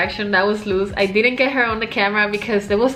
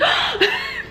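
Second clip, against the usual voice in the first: high gasping squeal, imitated; cute scream. Friend grabbing the picture, cute little gasped scream. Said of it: "the cutest scream".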